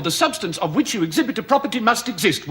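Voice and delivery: usual speaking voice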